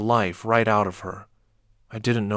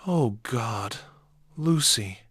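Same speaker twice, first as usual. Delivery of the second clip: shaking voice; slower than usual. A narrator's reading. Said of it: "My voice shook".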